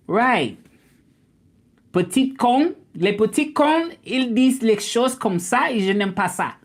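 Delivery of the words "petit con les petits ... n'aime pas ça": high-pitched scolding in French; telling off. Real, not acted. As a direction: tell someone off, pitched well up. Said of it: "told somebody off in French".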